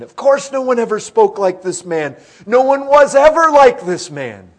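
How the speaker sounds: loud, rising to a high peak; fervent preaching. Real, not acted. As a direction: drive it home at full force. Preaching climax.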